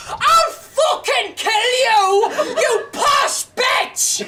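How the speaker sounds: slow, high-pitched mock heckler's shout; imitated heckler. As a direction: bellow it like an aggressive heckler. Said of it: "I got heckled with the phrase".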